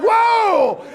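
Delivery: high, loud shocked whoa; alarmed whoa. Retold or imitated. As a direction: yelp as your son cuts the coffee table. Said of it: "I'm like".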